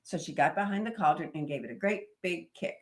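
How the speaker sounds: ordinary delivery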